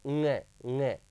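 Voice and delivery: ng said twice as held nasal nga; nasal pronunciation. Shown as a demonstration. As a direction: model ng twice from the nose. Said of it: "इसका उच्चारण कैसे करेंगे". (ng को दो बार नाक से "ङ" बोलें)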